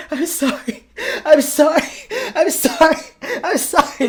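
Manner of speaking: high, loud sobbing; sobbing apology. Acted. Wallace sobbing into Larkin, a high crying apology. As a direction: cry out the apology through sobs. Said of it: "He is sobbing".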